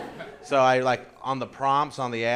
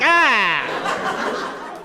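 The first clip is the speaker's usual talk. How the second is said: loud, high startled cry; shocked yelp; 10 semitones higher, 7 dB louder than usual. Retold or imitated. Comic reacting to a crude message, startled yelp. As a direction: recoil in shock. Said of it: "And you're like".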